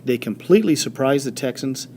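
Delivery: ordinary delivery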